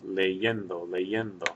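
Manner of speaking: "leyendo" with the stress on the "yen"; stress on second syllable. Shown as a demonstration. Teacher showing where stress falls, "leyendo" stressed on "yen". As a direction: lean on the E before the N in "leyendo". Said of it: "The stress that you hear is over that E".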